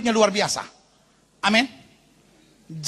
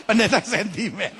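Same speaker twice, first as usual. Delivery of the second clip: words slowed through laughter; laughing. Real, not acted. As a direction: talk while laughing at your own joke. Laughing after own joke. (berkata sambil tertawa)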